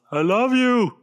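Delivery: lovestruck fan. Pretend. Trying to hug your TV.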